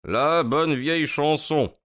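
high, drawn-out teasing; lightly sing-song, unimpressed detective; amused Holmes. Acted. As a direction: savour it with airy mockery.

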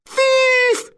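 frantic, very high screaming; absolute yells. A narrator's reading. Robbed wife raising the alarm at the racecourse, screaming. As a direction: yell for help at piercing pitch. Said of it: "She uttered absolute yells".

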